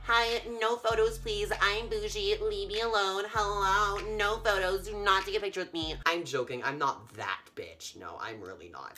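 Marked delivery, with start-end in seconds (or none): 0.1-6.0 diva vibe. Pretend. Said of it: "The vibes that I'm giving off right now is like".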